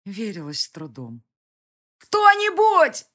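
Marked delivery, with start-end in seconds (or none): 2.0-3.0 very high, very loud drawn-out cry; desperate scream. Acted. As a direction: scream for help at full force. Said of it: "заорала я не своим голосом". (отчаянный крик о помощи)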